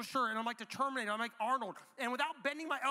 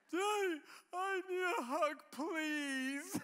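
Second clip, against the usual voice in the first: high, whiny mock sobbing; pleading toddler; 7 semitones higher, much slower than usual. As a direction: imitate a tot begging tearfully. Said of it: "He says it again".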